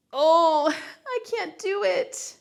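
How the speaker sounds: high, drawn-out mock wail; mock despair. Instructor joking she can't finish the reps, high mock wail. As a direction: playfully give up in a high, slow voice. Mock giving up mid-exercise.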